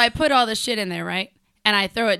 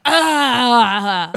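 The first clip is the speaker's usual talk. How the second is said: loud mock groan of disgust at smoke; obnoxious loud disgusted groan at a smoker; 4 semitones higher, 10 dB louder than usual. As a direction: groan loudly, laughing, at the smoker. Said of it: "I'm super obnoxious if somebody's smoking".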